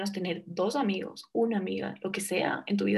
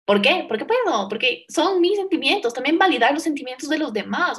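fast and loud, with conviction; passionate, louder; 5 semitones higher, 6 dB louder than usual. Real, not acted. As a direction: insist on it, louder and faster. Defends her right to cry. (apasionada y más fuerte)

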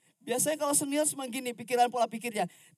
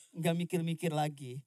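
ordinary delivery

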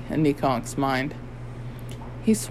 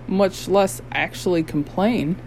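usual speaking voice

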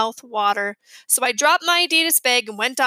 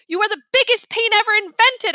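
higher and louder, furious at little brother; yelling; 9 semitones higher, 5 dB louder than usual. A narrator's reading. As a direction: yell at the pest. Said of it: "I yelled".

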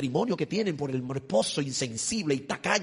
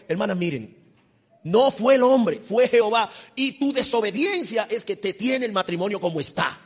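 ordinary talk

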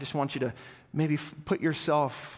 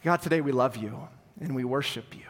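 prayer; 2 semitones lower than usual. Acted. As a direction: lead the congregation in prayer. Pastor begins closing prayer.

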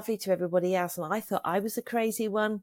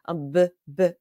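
a louder retold stutter, stuck on the b; 4 dB louder than usual. Sitting at the mirror, stuck on saying beautiful, stuttering. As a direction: stammer on the first sound, unable to finish. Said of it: "never had a stutter in my life".

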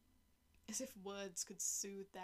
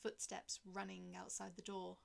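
ordinary delivery, nothing marked

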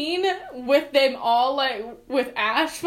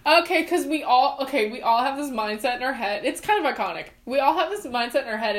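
ordinary delivery

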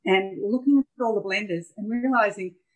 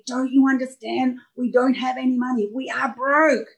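retold scream, higher but only a little louder; half-hearted replay of yelling at her daughter; mild mock yell; 6 semitones higher, 3 dB louder than usual. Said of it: "I screamed at the top of my voice".

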